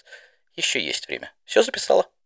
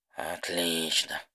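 slow, softer, sighed-out word; heavy sigh; 5 semitones lower, 7 dB quieter, much slower than usual. Acted. Rainbow sighing to Didi, weary and slow. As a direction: let the breath drop as you say it. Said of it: "Тяжело вздохнул Рэмбо Дэш".

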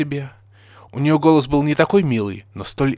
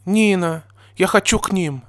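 high-pitched mild tearful plea; 8 semitones higher, 4 dB louder than usual. A narrator's reading. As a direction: plead as if close to tears. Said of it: "сказала со слезами Дёрпи".

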